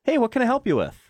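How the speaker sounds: high, chirpy customer-service greeting. Shown as a demonstration. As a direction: put on a helpful customer-service voice. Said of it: "I'd say my customer service voice".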